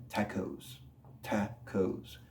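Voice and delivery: "tacos" with an aspirated T, tha-kohs; English airy T. Shown as a demonstration. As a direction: puff air on the T of "tacos", softly. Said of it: "no es tan golpeado como en español".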